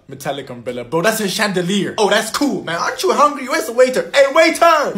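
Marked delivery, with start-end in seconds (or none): 4.1-5.0 shouting. Acted. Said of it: "Why are you shouting?".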